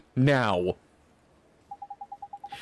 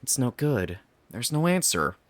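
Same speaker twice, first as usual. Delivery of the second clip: usual speaking voice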